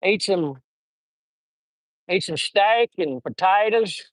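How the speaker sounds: slow, put-on Southern invitation; Southern accent. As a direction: stretch the vowels like a Southerner. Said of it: "I'd impersonate Southerners".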